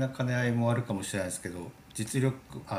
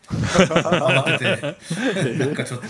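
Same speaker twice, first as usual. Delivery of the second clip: laughing; 8 semitones higher, 13 dB louder, much slower than usual. Real, not acted. Joking about rushing to read.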